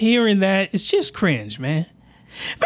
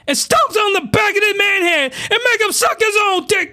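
outraged yelling; 9 semitones higher than usual. Real, not acted. Outraged reaction yelling at scene.

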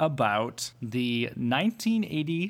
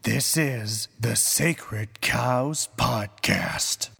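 movie trailer narrator; 5 semitones lower than usual. Acted. Trailer narration ending in whisper.

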